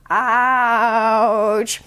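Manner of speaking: ordinary delivery, nothing marked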